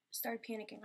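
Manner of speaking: usual speaking voice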